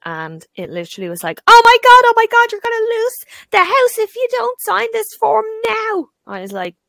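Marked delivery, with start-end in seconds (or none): 1.5-6.1 high, loud frantic caller; panicked shouting. Retold or imitated. Estate agent phoning Claire about losing the house, panicked shouting. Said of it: "stop shouting at people".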